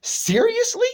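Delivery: squeaked-up, disbelieving seriously; can't believe it, pitch it way up; high incredulous seriously. Real, not acted. Incredulous at a friend's choice.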